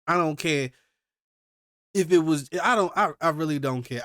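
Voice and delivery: loud, indignant insistence; heated rant. Real, not acted. Ranting about the killings, loud and indignant. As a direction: refuse to excuse it.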